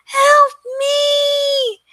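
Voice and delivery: very high, thin squeaky cry for help; tiny-voiced shout from the web; tiny little voice. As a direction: squeal it like a tiny fly-man. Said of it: "in this tiny little voice".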